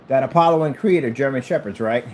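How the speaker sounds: ordinary talk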